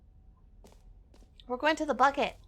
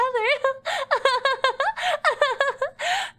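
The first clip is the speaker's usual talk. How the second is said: creepy mock giggle; 13 semitones higher, 3 dB louder than usual. Creepy laugh after mock threat.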